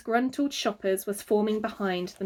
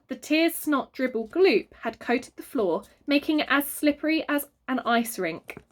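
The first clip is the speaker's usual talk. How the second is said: plain reading voice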